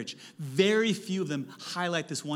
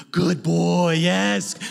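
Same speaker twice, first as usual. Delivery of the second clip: praising a dog; 2 semitones higher, 9 dB louder, much slower than usual. Pretend.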